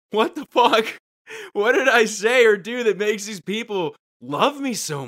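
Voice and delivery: mock panic at the fans' love; baffled celebrity. Tom Hanks baffled by Cleveland fans, loud mock bewilderment. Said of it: "he's just like".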